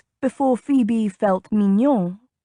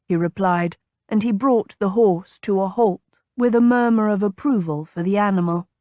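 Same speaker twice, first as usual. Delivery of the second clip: plain reading voice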